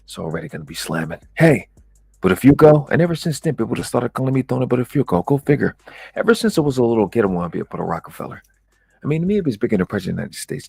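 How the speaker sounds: lightly voiced hustling promoter telling his story; boastful party promoter. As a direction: work the crowd as a self-styled big shot. Performs party promoter monologue.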